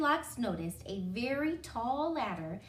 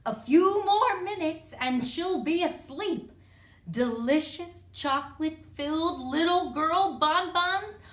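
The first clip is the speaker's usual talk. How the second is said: loud, high villainous relish; big hammy dinosaur voice; gloating dinosaur; 5 semitones higher, 6 dB louder than usual. A narrator's reading. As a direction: gloat over the coming meal. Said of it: "a dinosaur gloating".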